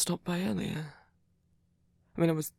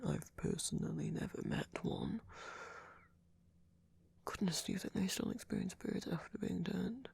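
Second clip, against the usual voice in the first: whispering; 2 semitones higher, 9 dB quieter than usual. Acted. Curious hushed musing.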